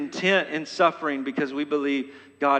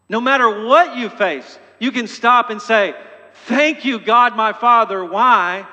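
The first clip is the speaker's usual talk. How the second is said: louder, higher exultant exhortation; preaching at full force; 6 semitones higher, 7 dB louder than usual. Acted. Preacher urging listeners to thank God, exultant. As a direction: lift them into thanksgiving.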